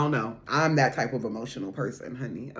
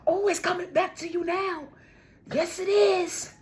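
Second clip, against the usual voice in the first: much higher, delighted; high excited squeal. Real, not acted. Reader squealing to viewers that it's coming back to them.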